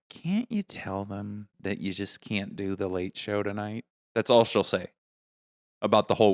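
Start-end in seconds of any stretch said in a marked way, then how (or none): 0.1-3.8 slow, soft imitation of a mother; worried mom. Mom to touring comedian son, soft worried pleading. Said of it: "my mom would be like".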